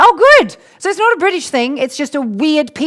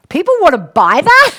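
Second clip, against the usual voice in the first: loud, high appalled disbelief; shocked at mushy peas, loud and high; disbelieving; 6 semitones higher, 8 dB louder than usual. Retold or imitated. Australian girl seeing mushy peas, loud shocked disbelief. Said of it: "And I went".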